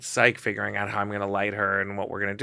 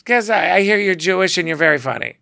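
higher, louder brassy diva; Joan Rivers; 6 semitones higher, 6 dB louder than usual. Retold or imitated. Joan explaining why she picked him, brassy crow. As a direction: crow it like a showbiz legend. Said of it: "And she goes".

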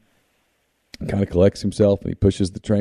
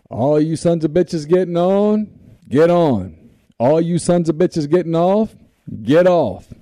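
higher, slow calling-out conductor voice; train conductor call; 8 semitones higher, slower than usual. Retold or imitated. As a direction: announce it like a conductor, slowly. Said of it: "He pulls it up".

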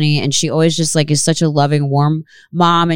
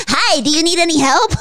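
cheery paperclip voice; 10 semitones higher than usual. Retold or imitated. Imitates paperclip assistant.

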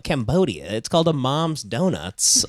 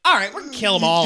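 mock villain vow; 4 semitones higher, faster than usual. Voicing Hitler in bit.

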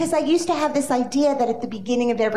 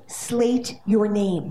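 slow ominous; 2 semitones lower, much slower than usual. Retold or imitated. Dreaded audition phrase, slow.

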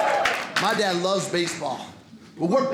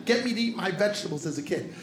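ordinary talk, nothing marked

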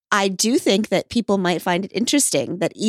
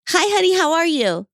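sweet greeting; 10 semitones higher, 4 dB louder than usual. Real, not acted. Greeting wife on air.

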